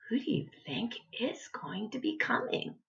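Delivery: hushed, secretive whisper. Real, not acted. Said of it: "whispered aside to children".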